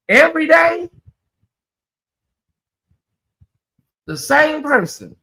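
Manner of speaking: incredulous. Real, not acted. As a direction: question it as if it's unthinkable. Appalled at lifelong monogamy.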